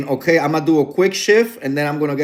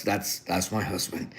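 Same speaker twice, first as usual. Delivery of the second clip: very low, much softer retold reply; subdued admission; 9 semitones lower, 14 dB quieter than usual. Wife answering flight attendant about husband, retold quiet admission. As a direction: drop right down, owning up quietly. Owning up to stern-looking husband.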